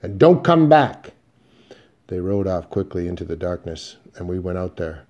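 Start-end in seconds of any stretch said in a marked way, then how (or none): 0.0-1.1 a loud, high parting shout into the dark. A narrator's reading. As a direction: yell it after them as you ride off. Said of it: "a voice shouted".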